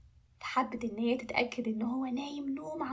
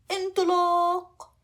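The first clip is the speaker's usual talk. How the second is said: loud, higher, long-drawn call; launch shout; 6 semitones higher, 7 dB louder, much slower than usual. Acted. Dragon takes off round the world, shouting. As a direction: stretch out the take-off cry.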